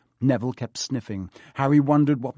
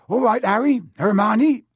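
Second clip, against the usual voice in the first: higher and louder friendly greeting; hearty Hagrid; 9 semitones higher, 8 dB louder than usual. Acted. Hagrid greeting Harry and Hermione, high and loud. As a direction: boom a cheerful hello.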